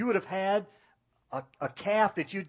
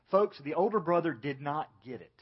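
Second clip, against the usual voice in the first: normal talking voice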